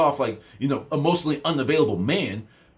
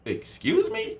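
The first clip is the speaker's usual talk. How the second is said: shocked, much higher "Excuse me?"; incredulous excuse me; 4 dB quieter, slower than usual. Retold or imitated. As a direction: act offended and disbelieving. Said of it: "I was like".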